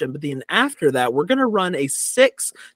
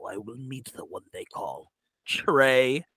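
loom over them like a dark lord; 5 semitones lower, 4 dB quieter than usual. Acted. Mock warrior challenge.

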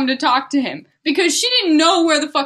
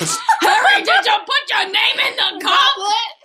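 put-on crying wise old man; 9 semitones higher, 3 dB louder, slower than usual. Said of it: "He started to cry wisely".

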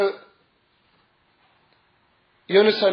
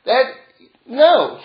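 ordinary talk

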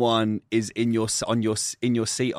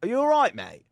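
pointed query; 10 semitones higher than usual. Retold or imitated. Retold challenge to hovering man.

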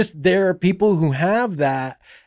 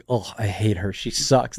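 low, soft dismissive mutter; muttered aside; 7 semitones lower, 6 dB quieter than usual. Retold or imitated. Director mutters his dismissal after she leaves. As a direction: grumble it under your breath.

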